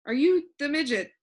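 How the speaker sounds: half believe it, half doubt it; a hint of uncertainty. A narrator's reading. Talk asks the tall man if he is the midget, uncertain. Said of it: "with a hint of uncertainty in his voice".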